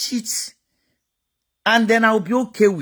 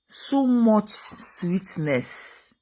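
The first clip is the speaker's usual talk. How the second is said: savoured slowly; 5 dB quieter, much slower than usual. Real, not acted. Savouring the word.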